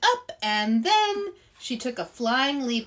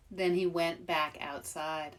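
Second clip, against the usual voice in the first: ordinary delivery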